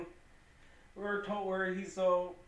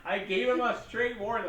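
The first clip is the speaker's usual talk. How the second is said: higher, louder defensive retort; playfully indignant, pitched up; mock protest; 6 semitones higher, 4 dB louder than usual. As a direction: plead your case to a buddy. Retelling own defensive protest.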